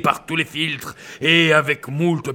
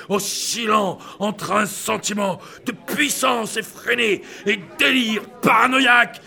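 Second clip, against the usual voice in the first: manic rant; 9 semitones higher than usual. Acted.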